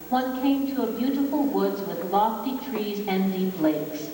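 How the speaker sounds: usual speaking voice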